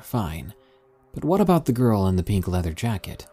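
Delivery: ordinary delivery, nothing marked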